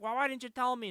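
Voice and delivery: high-pitched mock-upset mom; retold upset mom. Mom questioning her daughter, high mock-upset voice. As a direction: push the question up high, hammed-up.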